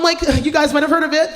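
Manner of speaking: normal talking voice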